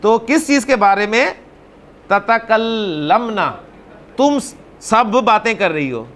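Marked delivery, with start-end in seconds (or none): 2.1-3.5 slow drill. Shown as a demonstration. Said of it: "teacher drills an Arabic verb".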